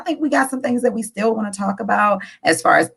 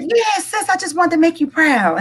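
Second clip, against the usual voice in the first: proud brother, acted; 10 semitones higher than usual.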